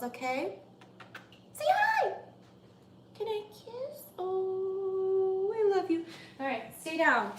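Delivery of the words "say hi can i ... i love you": doting high-pitched pet voice; cooing at pet. Real, not acted. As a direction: coo lovingly in a high little voice.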